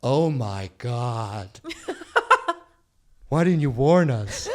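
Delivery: laughing, hammed-up shock; mock-horrified friends reacting to the girlfriend through laughter; mock horror through laughter. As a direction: gasp it out while cracking up.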